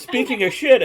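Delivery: loud and high, reading it out; loud excited reading. Real, not acted. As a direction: belt it out laughing. Reading chat comment, laughing.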